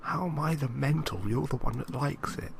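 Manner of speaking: ordinary talk, nothing marked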